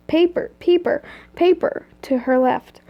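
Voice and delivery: usual speaking voice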